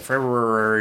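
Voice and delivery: February with extra rolling R sounds, Feb-rur-rary; too many R's. Retold or imitated. Host imitating his own weird recording, R-heavy February. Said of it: "there was way too many R's".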